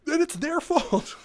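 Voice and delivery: high, drawn-out exasperated protest. Real, not acted. Clerk ranting about blamed customers, high exasperated protest. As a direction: let the grievance climb into a slow squeal. Worked-up rant reaches its peak.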